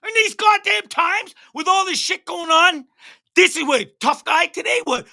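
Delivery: high-pitched outraged yelling at the cop; furious rant. Real, not acted. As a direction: let the outrage crack up high. Ranting at the abusive cop.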